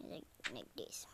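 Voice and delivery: whispered to himself; muttered whisper while concentrating. Real, not acted. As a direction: breathe it out, no voice. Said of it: "whispering to self while playing".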